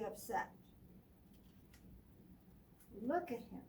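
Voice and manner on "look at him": usual speaking voice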